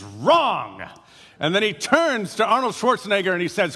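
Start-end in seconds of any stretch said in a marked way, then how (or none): 0.0-0.8 mock-stern father's curt rejection; a hint of a harsh king. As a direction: slam the answer down as a stern ruler. Father rejects son's answer.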